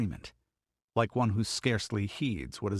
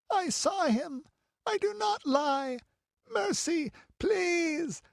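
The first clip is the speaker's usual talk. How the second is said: put-on very high, pained child's pleading; terrified, tearful high protest; frantic with fear; slower than usual. A narrator's reading. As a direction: beg desperately in a small high voice. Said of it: "too frantic with fear and pain".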